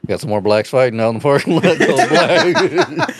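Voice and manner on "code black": mock code call. Joking about a restaurant emergency, mock call.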